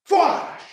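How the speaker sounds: sharp high whoosh of a blast; blaster whoosh. Acted. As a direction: spit out the blast sound. Blaster fire hits the manor.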